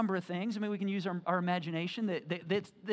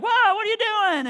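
startled shout; 14 dB louder than usual. Retold or imitated. Disciples startled by Jesus.

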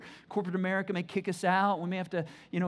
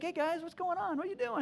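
put-on high, light, casual boy's greeting; a lightly voiced teen calling to brothers; breezy young David; 10 semitones higher, 4 dB quieter than usual. Preacher voicing young David greeting brothers, high and casual. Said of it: "he just shows up and he's like".

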